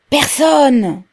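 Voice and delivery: high, loud shout of PERSONNE; echoing cry. A narrator's reading. A voice in the crowd echoes Pavel, crying out high and loud. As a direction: throw it back like an echo, loudly. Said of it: "Répéta une voix pareille à un écho".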